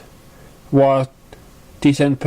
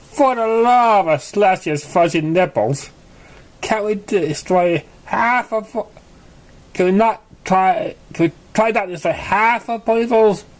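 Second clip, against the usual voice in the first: higher, exasperated filly outburst; exasperated yell; 7 semitones higher than usual. A narrator's reading. Apple Bloom fed up with exploding potions, yelling higher. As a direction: throw up your hooves and yell it. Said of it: "Apple Bloom yelled".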